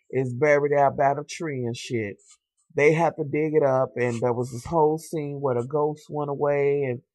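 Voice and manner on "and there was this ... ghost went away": ordinary talk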